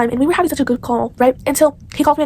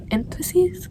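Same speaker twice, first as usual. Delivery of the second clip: high, soft questioning guess; half-ask the word, unsure; 6 semitones higher, 7 dB quieter than usual. Real, not acted. Girl to viewers, groping for the word: soft unsure question.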